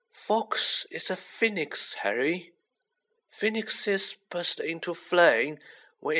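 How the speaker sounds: put-on unhurried old mentor, pitched high; kindly old headmaster. A narrator's reading. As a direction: explain it slowly in a lifted, measured voice. Voices Dumbledore in story.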